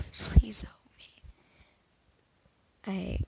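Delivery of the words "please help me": slow unvoiced whisper, pleading. A narrator's reading. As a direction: breathe the plea out with no voice. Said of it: "I whispered to Mister Aizawa".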